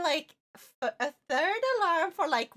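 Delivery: ordinary talk, nothing marked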